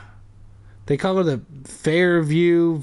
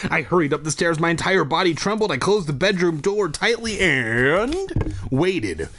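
ordinary talk

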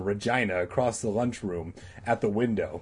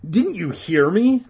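high, loud plea; crying out; 9 semitones higher, 7 dB louder than usual. Acted. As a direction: cry out to be heard. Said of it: "Todd cried".

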